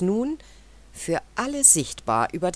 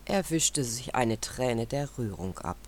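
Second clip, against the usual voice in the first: ordinary delivery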